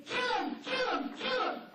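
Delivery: high loud repeated yells; frantic yelling. Pretend. Dog hears the owner's shouting as high loud yells. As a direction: yell it over and over, full alarm. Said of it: "what they hear is".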